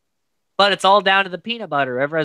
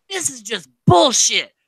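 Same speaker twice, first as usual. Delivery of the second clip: high, disgusted outburst; fed-up rant; 10 semitones higher than usual. Retold or imitated. Host mocking a fed-up houseguest, high disgusted outburst. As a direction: spit it out like he's done. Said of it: "He's like".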